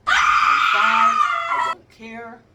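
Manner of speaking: put-on loud, high wailing child panic; mock crying shout. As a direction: wail like a terrified five-year-old. Five-year-old panicking, birds attacking.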